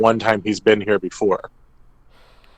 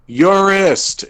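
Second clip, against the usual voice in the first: calling out a name; 10 semitones higher, 4 dB louder than usual. Retold or imitated. Calling out in tavern.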